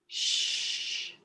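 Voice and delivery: whispered shush; secretive shush. Acted. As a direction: hush it like a sworn secret. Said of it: "Okay, Virgin Mary".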